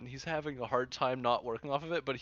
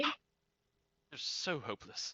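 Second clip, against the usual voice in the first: disdainful; 2 semitones higher, 7 dB quieter, much slower than usual. Acted. Haughty mermaid rebuke.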